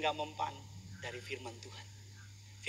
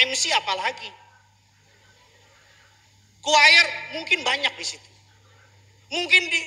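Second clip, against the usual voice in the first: loud, raised preacher's voice climbing higher; full-force preaching; 8 semitones higher, 13 dB louder than usual. Real, not acted. Preacher warning the congregation about hell, loud preaching. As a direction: drive the warning home at volume.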